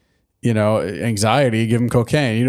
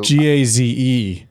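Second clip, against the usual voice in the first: letter by letter, slow; spelled out slowly; slower than usual. Shown as a demonstration. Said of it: "spelling it out".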